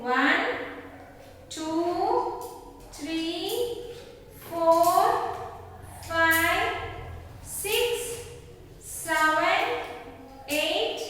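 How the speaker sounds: slow counting aloud with pauses; teaching a child to count. Shown as a demonstration. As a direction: count each ring out for the kids. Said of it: "Shall we both count together".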